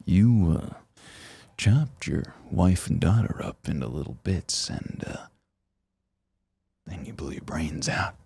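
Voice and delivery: hushed British butler, fading to a whisper; British accent. Retold or imitated. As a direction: whisper the grim reveal in English accent. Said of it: "your British accent's great".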